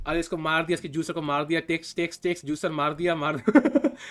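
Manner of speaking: low-pitched, hammed-up Indian-accent Hikaru. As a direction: drop the voice low and play Hikaru as a desi streamer. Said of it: "imagine if Hikaru was talking like this".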